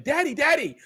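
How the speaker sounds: put-on high, loud excited kid calling Daddy; child's voice. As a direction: play the little kid tugging at dad.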